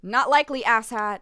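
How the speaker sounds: loud, dismissive comeback; irritated retort. Acted. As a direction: fire back at a rude customer. Clerk snaps back at rude customer.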